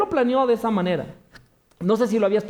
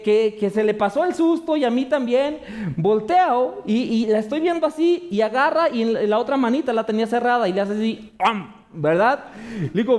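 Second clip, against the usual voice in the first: ordinary talk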